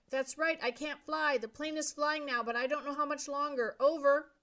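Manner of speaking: high, loud frightened reply; frightened calling. A narrator's reading. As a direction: call out with rising fear. Brian over radio.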